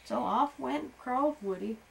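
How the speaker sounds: ordinary delivery, nothing marked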